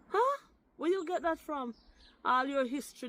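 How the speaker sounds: high-pitched, incredulous challenge; really incredulous questioning. Real, not acted. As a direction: shoot up high in disbelief. Challenging the other person's claim.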